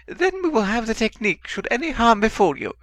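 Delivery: very high, loud desperate plea; pleading servant. A narrator's reading. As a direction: beg your master frantically. Wormtail continues pleading.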